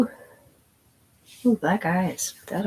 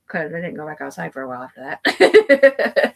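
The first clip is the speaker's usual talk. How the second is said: laughing; 7 dB louder than usual. Real, not acted. Laughing at own story.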